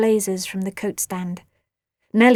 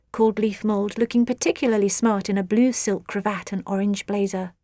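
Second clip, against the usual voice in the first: ordinary delivery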